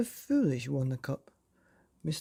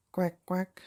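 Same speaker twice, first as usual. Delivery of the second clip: higher mock quack; duck quack; 4 semitones higher than usual. Worm voiced quacking.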